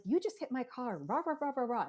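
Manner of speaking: lightly hammed-up angry stranger, not a full yell; mock angry accusation. Mom imitating the parked lady accusing her, mildly angry. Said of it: "she was very, very upset with me".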